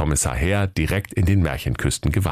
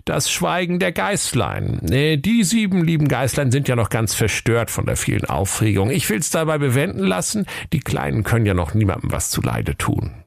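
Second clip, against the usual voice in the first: indulgent, higher-pitched musing; understanding inspector; 8 semitones higher than usual. A narrator's reading. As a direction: muse sympathetically about the little ones. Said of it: "verständnisvoll".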